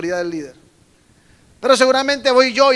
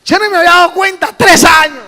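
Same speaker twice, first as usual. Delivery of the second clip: much higher and louder astonished shout; mock shocked pastor; 9 semitones higher, 12 dB louder than usual. Pastor hears the couple has dated three years, shocked shout. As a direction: blurt it out in disbelief. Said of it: "Yo digo".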